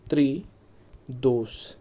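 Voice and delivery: त्रिदोष drawn out slowly; slow dictation. Shown as a demonstration. Teacher slowly writing त्रिदोष for the class. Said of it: "मुझे बताना यह शुद्ध है".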